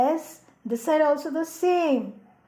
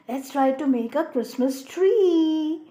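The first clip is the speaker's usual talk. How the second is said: ordinary talk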